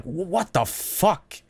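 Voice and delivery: slow, high, uneasy stammer; sickened disbelief. Retold or imitated. Witness seeing something that does not belong, queasy disbelief. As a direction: draw it out as if your stomach just dropped. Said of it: "weird, sickening feeling in your stomach".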